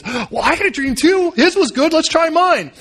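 high-pitched, excited jumping in; eager baker. Acted. Baker wanting his dream read too, eager excitement. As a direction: burst in hopefully, pitch climbing.

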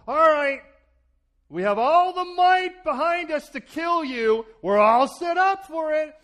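loud, high and slow, threatening; menacing accusers. Acted. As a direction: voice the mob closing in for the kill. Accusers corner the apostles, preacher voicing them.

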